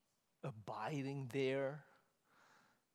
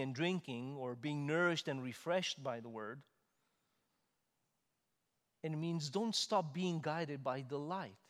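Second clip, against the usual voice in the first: fervent louder sermon build; preaching; 6 dB louder than usual. Acted. Preacher exhorts congregation to abide, fervent and loud. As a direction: urge the church to remain.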